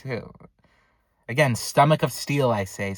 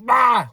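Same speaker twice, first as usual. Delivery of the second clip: loud, high burst of "ah"; mock struggle yell; 12 semitones higher, 9 dB louder than usual. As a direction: imitate a drunk yelling as he's grabbed. Struggle over the knife.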